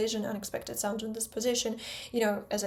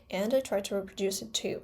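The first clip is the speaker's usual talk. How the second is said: ordinary talk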